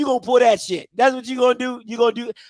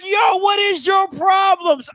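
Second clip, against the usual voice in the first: shocked; 7 semitones higher, slower than usual. Retold or imitated. Shocked at son's rudeness.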